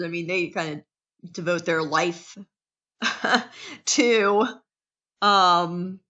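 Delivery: ordinary talk, nothing marked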